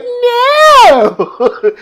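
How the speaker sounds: high cackling shriek of No; witchy shrieked No. Retold or imitated. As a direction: screech it like a witch. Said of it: "he's like".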